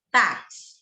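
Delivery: "táxi" with a firm TÁ, weak ending; teaching demo, weight on TÁ in "táxi"; stressed first syllable. Shown as a demonstration. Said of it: "é aqui, ó".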